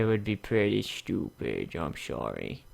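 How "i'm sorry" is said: ordinary talk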